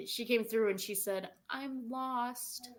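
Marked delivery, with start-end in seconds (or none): 1.5-2.7 put-on soft, high little lost girl; precious baby-doll voice. Telling what the little girl spirit said, precious baby-doll voice. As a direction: imitate a lost child, small and high. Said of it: "the most precious voice, baby doll".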